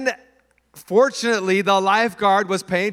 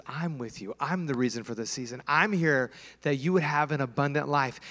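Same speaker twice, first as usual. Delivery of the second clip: gentle voice of Jesus; 5 semitones lower, 7 dB quieter than usual. Retold or imitated. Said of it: "Jesus says".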